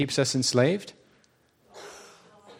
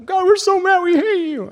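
much higher and louder, hammed-up fury; mock angry shout; 6 dB louder than usual. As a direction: parody a worship song yelling at God. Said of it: "be like".

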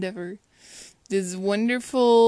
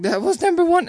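higher, louder alarmed count; mock the producer's panic; freaking out; 8 semitones higher, 6 dB louder than usual. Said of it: "And he freaks out".

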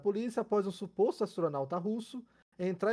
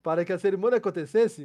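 ordinary talk

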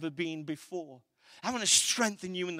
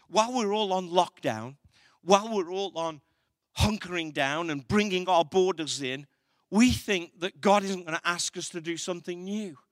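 raised, louder preaching voice; building sermon delivery; 5 dB louder than usual. Acted. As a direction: preach it out to the congregation. Preaching to congregation in lockdown.

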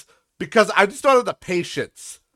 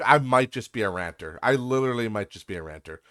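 normal talking voice

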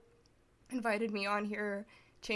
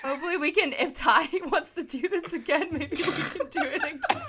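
higher and a bit louder, laughing through the words; 7 semitones higher, 8 dB louder than usual. Real, not acted. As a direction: tease him while cracking up. Laughing while teasing Ty.

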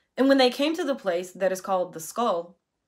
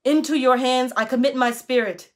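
loud, higher cry to heaven; Jesus calling out loudly from the cross; 4 semitones higher, 7 dB louder than usual. A narrator's reading. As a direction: call out to the Father with full voice. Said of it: "calling out with a loud voice".

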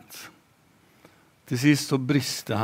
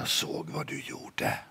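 whisper; 12 dB quieter than usual. Acted. Little sister whispers to her brother, sly whisper.

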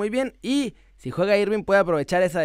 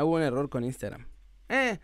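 ordinary talk, nothing marked

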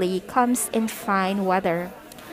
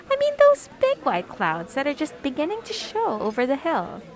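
ordinary delivery, nothing marked

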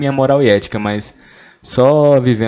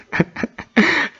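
high burst of laughter. Real, not acted. Reading a shocking question.

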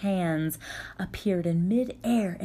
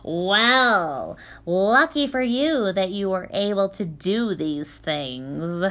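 sarcastic nasal; 6 dB louder, slower than usual. Acted. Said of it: "spoke sarcastically through her nose".

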